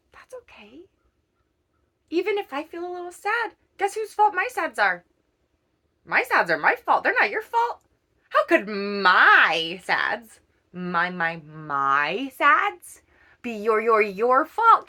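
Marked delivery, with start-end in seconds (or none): 0.1-0.9 soft whispered reassurance. Real, not acted. Sudden whisper. 6.0-14.9 loud, insistent, stumbling over my; emphatic reassurance. Real, not acted.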